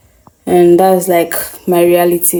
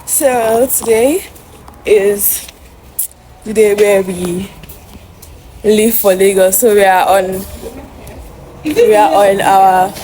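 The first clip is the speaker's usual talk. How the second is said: swollen face; 2 semitones higher than usual. Real, not acted. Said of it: "My face is swollen".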